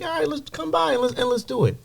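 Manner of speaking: high, far-off put-on voice for the boss. Retelling a friend's offer in a high put-on voice. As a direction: pitch it way up as the friend. [name] voiced in retold story.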